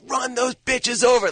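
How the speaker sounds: high, drawn-out mock holler; imitated scream. Director imitating an excited woman in the cinema, screaming. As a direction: holler it slowly at the movie screen. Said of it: "it was like screaming".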